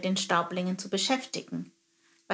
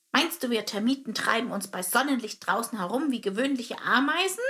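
proud termite captain; 3 semitones higher than usual. Acted. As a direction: sneer at common ants.

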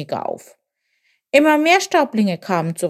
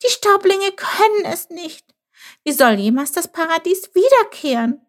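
high-pitched, anguished little voice; despairing; 11 semitones higher than usual. A narrator's reading. Chilp despairs of paradise ever returning, high and anguished. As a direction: lament it helplessly, pitched far up. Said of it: "Chilp war verzweifelt".

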